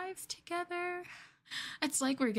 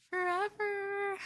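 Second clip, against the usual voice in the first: high, slow, sing-song forever; dreamy forever; drawn-out forever; 10 semitones higher, slower than usual. Acted. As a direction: stretch it out sweetly. Promising eternal togetherness.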